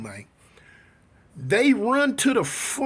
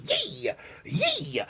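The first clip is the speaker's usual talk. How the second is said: very high, thin "yee"; imitated battle cry; 5 dB quieter than usual. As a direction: squeal out mock sword-swing cries. Imitates the sword-swinging cries.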